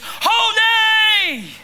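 a long, high, loud cry of holy; high ringing shout of holy; awestruck shout. Acted. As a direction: throw the word holy out in overwhelmed wonder. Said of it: "Or to put it in biblical language".

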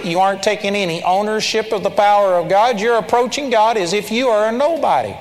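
ordinary delivery

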